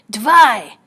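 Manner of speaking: put-on guard yelling at the children to move; yelled order. A narrator's reading. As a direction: yell it like a soldier herding prisoners. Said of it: "the voice yelled".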